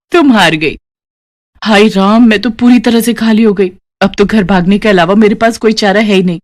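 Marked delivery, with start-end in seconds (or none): none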